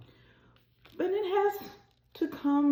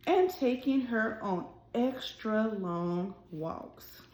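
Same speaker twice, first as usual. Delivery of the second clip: gentle, expressive storybook reading; reading to children. A narrator's reading. Teacher reading a picture book aloud to her class, expressive storytelling. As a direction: read it like story time for little kids.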